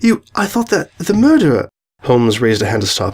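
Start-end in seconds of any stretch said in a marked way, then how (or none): 0.0-1.7 high, halting alarm; alarmed faltering. Acted. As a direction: falter in fright, voice pitched up. Agitated Miss Hyman after the bang.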